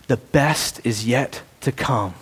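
low, soft and slow; soft, slow reassurance; tender promise. Acted. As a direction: say it gently like a promise. Voicing Jesus' promise.

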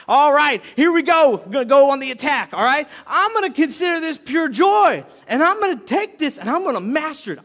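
loud, rising pep-talk fervor; pumped-up resolve. Acted. Believer going on the attack, pumped up. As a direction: psych yourself up to charge in. Acts out attacking a trial gladly.

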